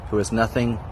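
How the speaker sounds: ordinary delivery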